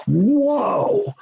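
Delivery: high, drawn-out stunned whoa. Retold or imitated. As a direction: let the disbelief ring out. Stunned by supervisor's answer.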